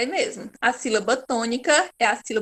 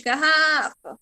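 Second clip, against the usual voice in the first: "garrafa" with a stretched middle syllable; RRA drawn out; 3 semitones higher, slower than usual. Shown as a demonstration. Teacher showing which syllable is tonic, RRA drawn out. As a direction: lengthen the RRA in "garrafa", as if calling. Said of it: "vai se estender um pouquinho mais".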